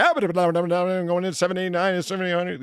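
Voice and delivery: fast, high, loud auctioneer chant; auctioneer patter. Retold or imitated. Showing how auctioneers yell for a living, in fast, loud patter. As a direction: rattle it off like an auctioneer. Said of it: "the guys are like".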